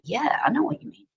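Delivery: high, whispery agreement; very soft, airy imitated reply; mock hushed reply. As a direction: toss it off in a light whisper. Acting out her casual reply.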